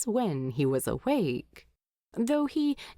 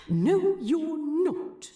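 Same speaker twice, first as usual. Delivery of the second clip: sharp, higher "No" then a firm "you're not"; indignant retort; 9 semitones higher, slower than usual. Acted. Sleepy hobbit contradicting Thorin, indignant. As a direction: cut him off, protesting.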